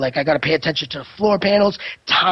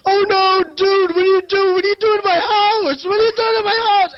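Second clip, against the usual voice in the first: high, loud mock panic, pleading; imitating a terrified freak-out; 12 semitones higher, 4 dB louder than usual. Retelling a victim catching him robbing the house, mock terrified. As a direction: act out a homeowner panicking at an intruder. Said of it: "freaks out with such actual emotion in his voice".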